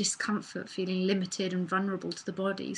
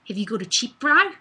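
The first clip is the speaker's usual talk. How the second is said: New Zealand accent; 2 semitones higher, 9 dB louder, faster than usual. Shown as a demonstration. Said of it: "a New Zealand accent".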